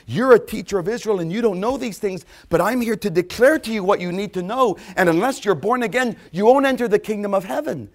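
preaching at full force; forceful declaration. Acted. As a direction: declare it straight to Nicodemus. Jesus voiced forcefully.

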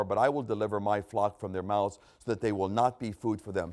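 solemn, measured scripture reading. Acted. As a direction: read God's words with weight. Reads scripture in sermon.

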